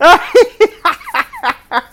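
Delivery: loud high cackle; helpless high-pitched laughter; real laughing fit. Real, not acted. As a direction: burst out in a long, high laugh. Cracking up at game dialogue.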